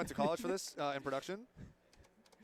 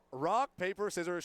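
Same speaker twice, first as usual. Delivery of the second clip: ordinary delivery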